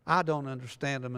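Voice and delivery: ordinary talk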